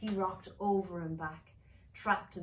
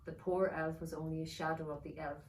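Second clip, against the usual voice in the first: ordinary delivery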